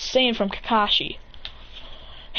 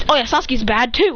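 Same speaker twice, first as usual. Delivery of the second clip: usual speaking voice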